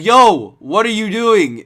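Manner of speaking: loud high yo; calling out. Retold or imitated. Worker yells at the bum coming back in, loud call. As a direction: shout across at him. Calls out to returning dude.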